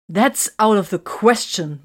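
loud, slow, firm refusal; emphatic. A narrator's reading. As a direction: underline every word, firmly. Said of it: "Vox underlined".

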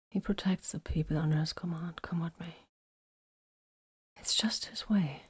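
unvoiced hushed explanation; whispered. A narrator's reading. Vox to Titus, whispered explanation. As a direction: say it in a bare whisper.

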